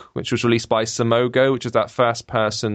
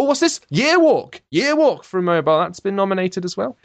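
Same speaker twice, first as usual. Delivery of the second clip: pleased surprise; 7 semitones higher than usual. Real, not acted. Spotting a favourite on the list.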